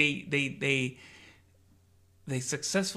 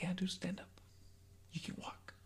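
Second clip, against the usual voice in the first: soft, near-whispered command; hushed reassurance; 4 semitones higher, 13 dB quieter than usual. Acted. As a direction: say it quietly, almost under the breath. Acting out Elon's quiet command.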